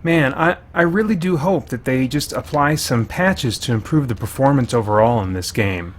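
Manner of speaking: usual speaking voice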